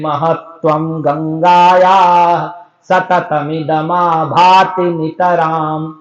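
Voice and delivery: very slow, every त in "महत्वम गंगायाः सततमिदमाभाति नितराम" clearly sounded; repeated ta sound. Shown as a demonstration. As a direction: draw out "सततमिदमाभाति" so each त rings. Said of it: "बार बार ता की ध्वनि आएगी".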